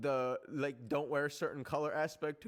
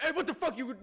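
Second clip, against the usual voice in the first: high, loud mock yell, half laughing; hammed-up confrontational shout; imitated angry driver; 12 semitones higher, 6 dB louder, faster than usual. Acting out driver yelling at her.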